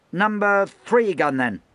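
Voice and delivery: high, loud and slow, curtly annoyed; irritable. Acted. Lieutenant brushing off a new hand, irritable. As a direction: snap it out, busy with paper. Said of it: "the lieutenant said irritably".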